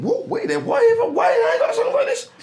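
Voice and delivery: high-pitched, incredulous self-quote; near-jealous disbelief. Retold or imitated. Musician recalling hearing another artist's interview, near-jealous disbelief. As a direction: act out indignant surprise, pitch leaping up. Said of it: "I'm almost not jealous".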